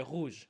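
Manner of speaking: rouge with a throaty, unrolled French R. Shown as a demonstration. French speaker showing how they would say it, French R. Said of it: "we would say".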